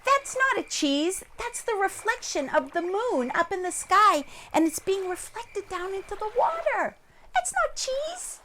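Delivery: high-pitched explaining crane; know-it-all crane. Acted. Crane correcting the silly wolf, high-pitched lecture.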